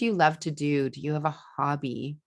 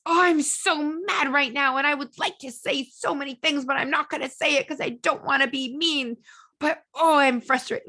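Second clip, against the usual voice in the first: much higher, louder mock angry yelling; demo mad yell; 10 semitones higher, 3 dB louder than usual. Shown as a demonstration. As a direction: let the frustration out in a hammed-up yell, peaking at the end. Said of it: "you yell".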